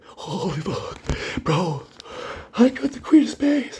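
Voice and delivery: whimpering, high-pitched mock sob; Terrence, almost crying. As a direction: imitate a freaked-out friend close to tears. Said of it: "Terrence is almost crying, freaking out".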